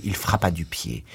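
ordinary delivery

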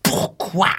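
sudden high outraged cry; shouted. A narrator's reading. Gromov shouting back at the doctor, high outburst. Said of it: "hurla Gromov".

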